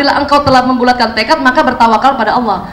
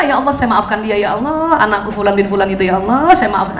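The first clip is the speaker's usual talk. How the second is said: quiet forgiving prayer; 6 semitones lower, 3 dB quieter than usual. Shown as a demonstration. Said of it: "Harus ngomong, Bu".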